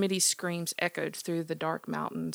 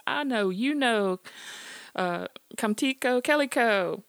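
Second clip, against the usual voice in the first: loud high Southern call to the dogs; 6 semitones higher, 7 dB louder than usual. Acted. Old man calling his dogs from the door, loud high holler. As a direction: holler for the dogs across the yard.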